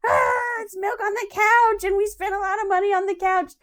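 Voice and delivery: imitating herself wailing at spilled milk on the couch; mock exasperated cry. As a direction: overplay the outrage about the couch. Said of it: "And I'm like".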